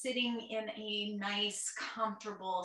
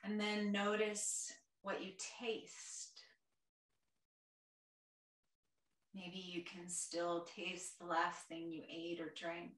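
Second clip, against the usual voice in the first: guided meditation; 3 semitones lower, 6 dB quieter than usual. Real, not acted. Yoga teacher guiding a chair class through their senses, soft meditation voice. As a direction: gently guide them inward, quieter and lower than chat.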